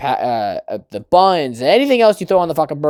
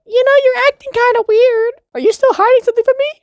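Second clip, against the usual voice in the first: very high, mock girly voice; needling questions in a squeaky falsetto; suspicious girlfriend; 5 dB louder than usual. Girlfriend to boyfriend acting weird, suspicious.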